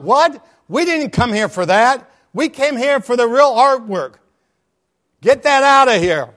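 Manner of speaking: loud, high outraged yell from the back; screamed protest. Acted. Bidder in the back yelling at the auctioneer, outraged. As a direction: shout it down like an angry bidder. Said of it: "somebody in the back screamed out".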